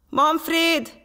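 loud, high-pitched call for brother; desperate cry. A narrator's reading. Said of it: "kiáltotta kétségbeesett hangon".

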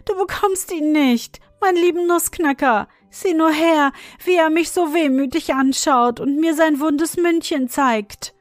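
put-on tearful girl. A narrator's reading. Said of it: "rief Marie weinend".

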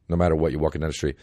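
ordinary talk

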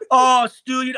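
high, loud mocking "oh"; mock his flustered protest; mimicked backpedaling rival. Player mimicking the rival who backed down, mocking.

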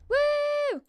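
high, loud cheer of joy; real delight at the new sword; excited whoop. Real, not acted. Player cheering his new sword, high excited whoop.

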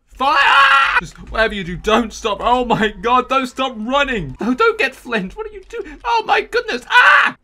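panicked high shouting; breathless fright. Real, not acted. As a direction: scream while running away. Fleeing fire enemies.

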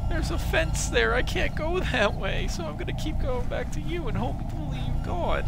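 high, whiny pretend pleading; a hammed-up victim begging the monster; mock little girl plea.

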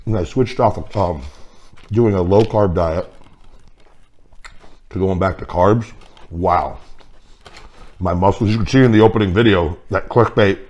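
chewing food while speaking; eating between words; mouth full. Real, not acted. Seen 1: bites pastry at -5s, chews with full cheeks while talking.

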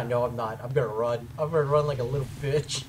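very deep, soft voice tipping into laughter; low comic retreat. Real, not acted. Gamer giving up protecting to run, low amused mutter. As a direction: admit it low and quiet, then start to laugh. Jokingly backing down from defence.

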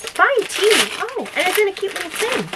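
high, excited cooing; thrilled squeaky-high gushing; delighted. Real, not acted. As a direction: exclaim high with glee at a gift. Delighted at unwrapped tea.

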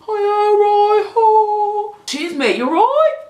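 high, loud Aussie singsong; overdone Aussie with a lilt; mock Australian accent. Shown as a demonstration. Mocking Australian slang to viewers.

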